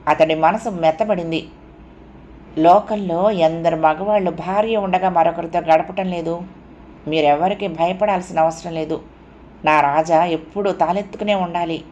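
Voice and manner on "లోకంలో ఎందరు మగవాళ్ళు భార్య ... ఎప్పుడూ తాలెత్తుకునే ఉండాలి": plain reading voice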